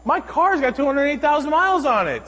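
high, loud mimicry of a nagging stranger; exasperating broken-record whine, mock strident; repetitive insistent woman. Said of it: "For the fourth time she's told me".

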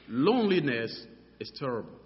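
ordinary talk

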